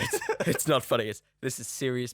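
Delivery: laughing through mock seriousness. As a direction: pretend stern, giggling.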